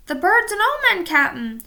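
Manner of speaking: high, loud pirate shout; pirate warning his captain of the storm. A narrator's reading. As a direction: yell it as a frightened warning. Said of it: "shouted Stinky".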